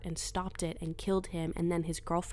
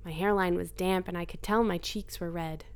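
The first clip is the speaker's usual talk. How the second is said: ordinary delivery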